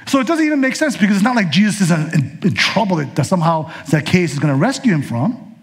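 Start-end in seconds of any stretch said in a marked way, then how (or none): none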